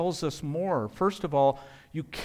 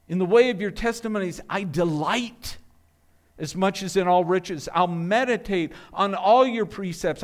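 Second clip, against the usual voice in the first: preaching force; 2 semitones higher, 5 dB louder than usual. Acted. Reads the psalm with building force.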